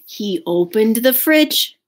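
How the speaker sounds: ordinary delivery, nothing marked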